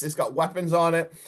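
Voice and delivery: ordinary delivery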